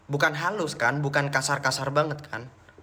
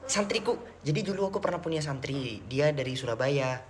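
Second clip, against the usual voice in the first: normal talking voice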